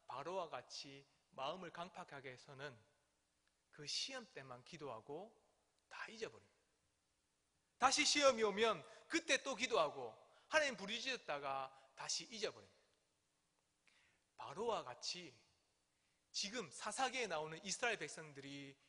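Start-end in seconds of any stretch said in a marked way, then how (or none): none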